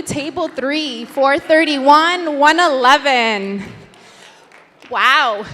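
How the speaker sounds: ordinary talk, nothing marked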